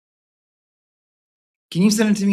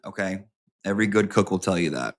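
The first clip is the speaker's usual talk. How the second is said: ordinary talk, nothing marked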